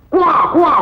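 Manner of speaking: a hammed-up baby wail; baby's cry. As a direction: imitate a crying baby. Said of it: "குவான்னு குழந்தை கத்திச்சு".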